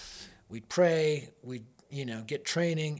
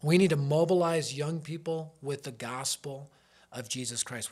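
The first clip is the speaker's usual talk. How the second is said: normal talking voice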